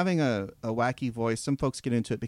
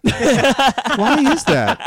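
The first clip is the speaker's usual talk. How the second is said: ordinary talk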